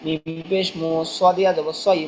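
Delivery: ordinary talk, nothing marked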